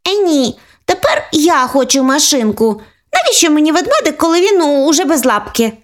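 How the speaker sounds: put-on high fussy child voice; capricious little girl. Older sister demanding the toy car, high fussy child voice. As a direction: turn up your nose at the bear.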